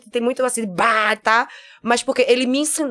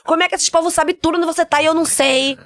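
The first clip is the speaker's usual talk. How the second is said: upset father; 6 semitones higher than usual. Retold or imitated. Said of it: "father's complaint retold".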